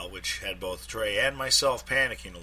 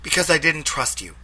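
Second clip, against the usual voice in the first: Ruth on the phone; 8 semitones higher, 5 dB louder than usual. A narrator's reading.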